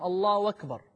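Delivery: "الله أكبر" said with a w gliding into "أكبر", as "wakbar"; hamza turned waw. Shown as a demonstration. Teacher shows how some say takbir, "أكبر" becoming "wakbar". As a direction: swap the hamza of "أكبر" for a w sound. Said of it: "يقلب الهمزة واوا".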